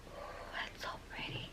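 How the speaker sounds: hushed, voiceless whisper of admiration; awed whisper. Real, not acted. Vlogger admiring a pink swatch, awed whisper. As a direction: whisper it, awestruck.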